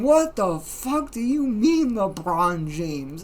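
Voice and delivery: loud, slow mock outcry of disbelief; going wild. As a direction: start high and loud, stretching every word in bafflement. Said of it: "all of us fucking going wild in the call".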